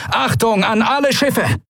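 high, strained call to the fleet; commanding call. A narrator's reading. Quailor to all ships in battle, high commanding call. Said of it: "rief Quailor".

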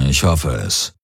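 low grumble from the commander; grumbling. A narrator's reading. Commander to Galen about the persona in his head, grumbling. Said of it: "brummte Daraios".

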